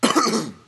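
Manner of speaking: sharp high choking cough; coughing up water. Real, not acted. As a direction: cough hard after swallowing wrong. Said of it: "Went down the wrong pipe".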